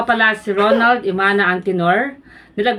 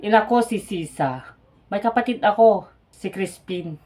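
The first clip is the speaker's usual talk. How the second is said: Basilio, subdued; 3 semitones lower than usual. Acted. Opens monologue as Basilio. (mahina at mababang boses)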